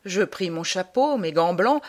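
ordinary delivery

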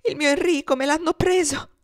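high, loud panicked outburst; desperate mother's scream of alarm, acted; agonized cry. A narrator's reading. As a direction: cry out for a stolen child. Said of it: "si rizzò bruscamente gridando".

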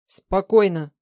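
steady her with a forceful word; tense. A narrator's reading. Man calming a panicking partner, tense and firm. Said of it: "напряжённо произнёс Влад".

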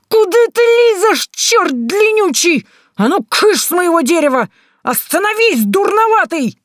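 very high panicked shrieking; yelling at the top of her lungs. Acted. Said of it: "голося во всё горло".

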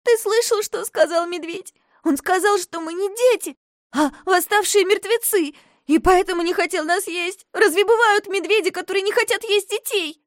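put-on high, loud anxious girl; girl's voice. A narrator's reading. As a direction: press him, upset.

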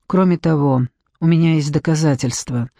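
ordinary delivery

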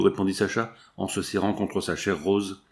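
plain reading voice

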